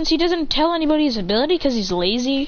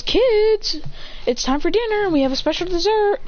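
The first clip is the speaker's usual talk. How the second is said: put-on higher motherly call to the kids; mom calling; 8 semitones higher than usual. Mom calls the kids to dinner, higher calling voice. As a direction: call them in for dinner, sweetly high.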